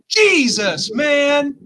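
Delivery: loud, high-pitched startled yell; retold shout. Storyteller acts out the splattered fan's reaction, shouting. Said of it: "his instant reaction was".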